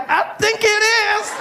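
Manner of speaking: high, slow bashful voice; flattered reply. Retold or imitated. Flattered stranger replies shyly, high and slow. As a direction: answer shyly, a little flattered. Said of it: "And you'll be like".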